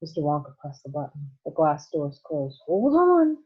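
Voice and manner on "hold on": high, loud warning cry; cried out. Acted. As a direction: call out to brace everyone. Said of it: "cried Mister Wonka".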